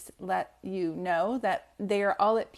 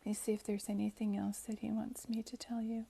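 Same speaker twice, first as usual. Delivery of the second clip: whisper; 9 dB quieter than usual. Real, not acted. Medium checking with spirit, aside.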